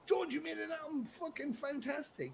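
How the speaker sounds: high, rising cheer for a mate; mock praise. Mates congratulating George on his album, excited. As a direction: hype up your friend.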